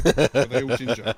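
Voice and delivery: loud laughing question, slow; can barely get it out for laughing. Real, not acted. Teasing the Irish family, a loud slow laughing question.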